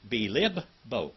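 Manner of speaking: Bilibbo said slowly, both b's held apart; teacher slowing Bilibbo to show the two b's; stretched for the double b. Shown as a demonstration. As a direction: draw out Bilibbo so the doubled b is heard. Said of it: "There's two B's in there, two B's".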